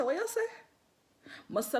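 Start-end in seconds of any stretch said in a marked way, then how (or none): 0.0-0.5 high-pitched puzzled question; taken aback. Retold or imitated. Man hears about granny's dream, taken aback. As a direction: imitate a man querying in disbelief, pitched up.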